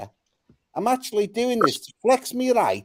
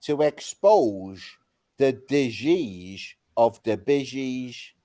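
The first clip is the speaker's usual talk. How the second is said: very slow, lower, each word laid out like a catchphrase; mock-grand slow pronouncement; slow slogan delivery; 6 semitones lower, much slower than usual. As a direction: savour the line 'to expose the disease of the busies' like a slogan. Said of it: "Do you like that?".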